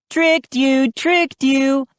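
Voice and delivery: high gleeful taunt; cheered. A narrator's reading. High singsong gloating. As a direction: Joe teases Mindy, gleeful cheer. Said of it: "I cheered".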